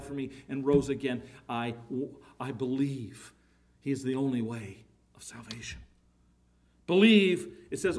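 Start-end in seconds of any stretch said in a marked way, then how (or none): 1.5-5.8 a private, awed whisper of faith; hushed whisper. Acted. Preacher whispering his own creed to the church, hushed. 6.9-7.6 shouted word. Acted. Preacher driving the one word home to the church, shouted.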